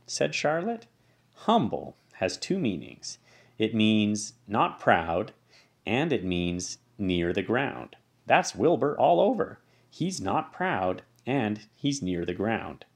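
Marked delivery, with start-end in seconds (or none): 1.5-12.9 slow explaining. Acted. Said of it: "Charlotte explains the word".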